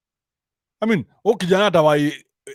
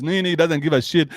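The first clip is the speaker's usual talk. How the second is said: ordinary talk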